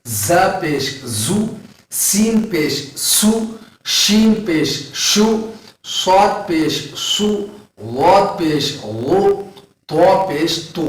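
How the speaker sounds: letters with pesh said very slowly, syllable by syllable; slow pesh drill. Shown as a demonstration. Teacher drilling pesh syllables for students, slow. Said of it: "اسی طرح پیش کی مثال".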